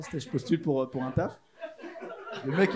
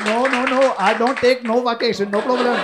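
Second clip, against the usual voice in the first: loud, high, slow Indian-English impression; Indian-accented English; 5 semitones higher, 9 dB louder, slower than usual. Candidate reassuring the recruiter, loud exaggerated Indian accent. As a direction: insist loudly in a put-on Indian accent. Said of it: "Je suis parti en Inde".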